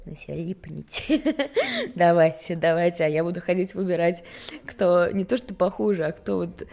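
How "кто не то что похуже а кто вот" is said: usual speaking voice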